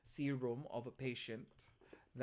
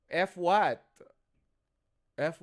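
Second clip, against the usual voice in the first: higher, loud demanding "F what"; loud prompt; 4 semitones higher, 13 dB louder, slower than usual. Real, not acted. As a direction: push the student for the answer. Teacher prompting for answer.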